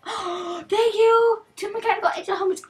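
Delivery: high, loud, rushed delight; excited thanks. Real, not acted. Streamer thanking viewer for helmets, high and thrilled. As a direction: squeal the thanks, then race on. Surprised by a gift.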